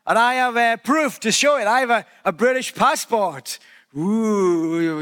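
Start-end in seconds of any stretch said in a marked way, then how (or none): 4.0-5.0 mock impressed ooh. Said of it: "you might say".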